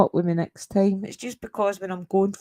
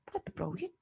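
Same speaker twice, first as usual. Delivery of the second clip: breathy whispered aside; 5 semitones higher, 17 dB quieter than usual. Real, not acted. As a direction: drop to a whisper, just for them. Aside to someone off-stream.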